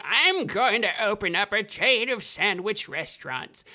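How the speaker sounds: put-on slurred old man putting on a big announcement. Rich old man declaring a business plan, old man voice. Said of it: "when I'm an old man".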